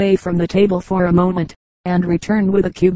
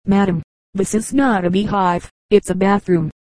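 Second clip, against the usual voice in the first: usual speaking voice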